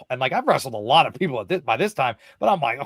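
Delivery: ordinary talk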